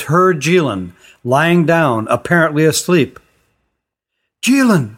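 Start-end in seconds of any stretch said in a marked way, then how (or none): 4.4-5.0 high call of his name; girl crying out her friend's name. A narrator's reading. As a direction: call out to him, high and urgent. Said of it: "she cried".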